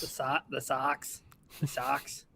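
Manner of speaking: bad Boston accent on Sox; mangled Boston accent, slow. Shown as a demonstration. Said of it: "we both can't do Boston accents".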